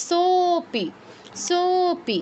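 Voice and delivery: সোপি with the সো held extra long; drawn-out pluta vowel. Shown as a demonstration. Teacher drawing out সোপি for the students. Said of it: "দীর্ঘ থেকে বেশি সময় নিয়ে উচ্চারণ করতে হবে".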